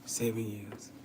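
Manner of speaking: softly whispered, slow; hushed reflection. Real, not acted. The speaker telling viewers how long the words replayed, whispered. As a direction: breathe it out almost silent.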